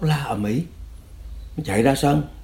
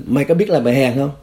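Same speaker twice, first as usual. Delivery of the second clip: imitated interrogator, raised pitch and louder; badgering interrogator; 4 semitones higher, 5 dB louder than usual. Cadre berating his prisoner, mock angry. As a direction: fire the insult as a demanding question.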